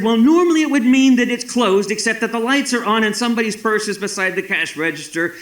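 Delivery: high-pitched retold protest, loud at first; exasperated explaining. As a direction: argue back in mock exasperation. Arguing with the woman at door.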